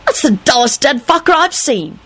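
put-on high, irritable kid voice. A narrator's reading. Tim complains about the corpse, irritably. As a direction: complain high, speeding up at the end. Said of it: "Tim announced irritably".

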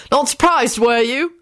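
cheerful teasing grin; grinning older boy. A narrator's reading. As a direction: grin through the line. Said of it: "grinned one of the oldest Gryffindors".

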